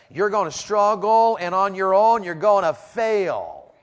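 loud, high-pitched pulpit exhortation; full-force preaching. Real, not acted. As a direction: drive it home to the whole congregation.

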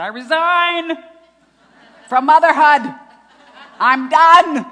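high, very loud hammed-up resignation; comic wailing declaration of defeat; mock tearful outburst. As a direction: yell it out like a mother at the end of her rope. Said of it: "I'm crying, and I said".

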